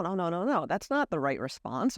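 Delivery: ordinary talk, nothing marked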